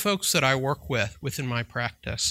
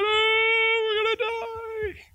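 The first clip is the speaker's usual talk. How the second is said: much higher, hammed-up wail of fear; mock panic; much slower than usual. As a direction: play the terrified disciples in the storm. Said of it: "everybody's freaking out".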